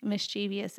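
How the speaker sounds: "mischievous" as mis-CHEE-vee-us; four syllables in "mischievous", stress on CHEE; wrong pronunciation. Shown as a demonstration. Wife ruling on the word, "mischievous" as mis-CHEE-vee-us. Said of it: "is totally incorrect".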